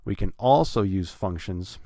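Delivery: "also" punched on its first syllable, "functions" lighter; stress on also. Shown as a demonstration. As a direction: hit "also", then ease off. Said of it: "it's the first syllable".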